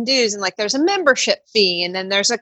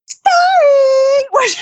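very high, wailing fake-sorry; a whimpering, pitiful sorry; mock whiny apology; 4 dB louder than usual. Mimicking the agent's apology as a high, louder fake wail. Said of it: "And they're like".

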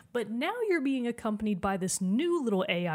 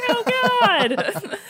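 high, loud laughing "oh God"; mock dismay; 13 semitones higher, 11 dB louder than usual. Player reacting to GLaDOS's cruelty, laughing mock dismay. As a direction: gasp it out, half laughing in horror.

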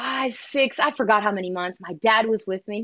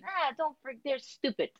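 soft, calming dad voice, much quieter; 3 semitones higher, 12 dB quieter than usual. Retold or imitated. Dad calming his daughter about haters, soft and much quieter. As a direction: hush her like a steady father. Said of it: "'cause he's usually the voice of reason".

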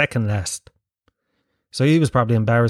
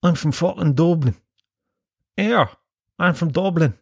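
mock Dubliner, higher voice; exaggerated Dublin accent, pitched up; terrible Dublin accent; 4 semitones higher than usual. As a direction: put on a bad Dublin accent to heckle. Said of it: "That's a terrible Dublin accent".

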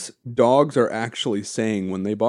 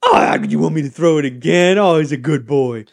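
louder, higher cooing at a pet; doggy baby talk; 4 semitones higher, 7 dB louder than usual. Pretend. Owner praising the dog, doggy baby talk.

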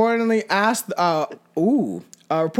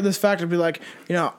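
ordinary talk

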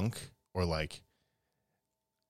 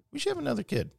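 much higher-pitched acted quote; mock parental suggestion; much faster than usual. Parent floating the idea of another baby, mock high voice. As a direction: pitch it way up, imitating a hopeful parent.

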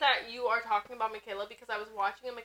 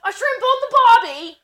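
mock Australian accent; 10 semitones higher, 13 dB louder than usual. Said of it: "what accent would it be".

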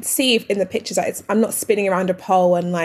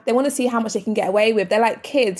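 usual speaking voice

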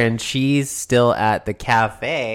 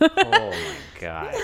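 laughing disbelief; 4 dB quieter, slower than usual. Real, not acted. Laughing at "Yes" to being eight.

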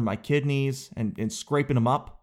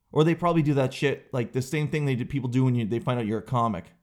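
usual speaking voice

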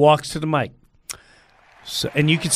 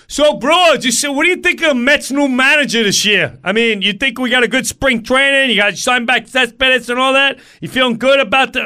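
loud, high, pestering bro; hammed-up chummy pest; oblivious chatterbox; 7 semitones higher, 4 dB louder than usual. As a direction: barge in with high, loud small talk. Oblivious chatterbox interrupts his rehearsal.

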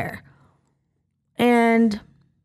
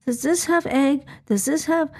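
put-on worried child; 6 semitones higher than usual. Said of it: "he worries about, like".

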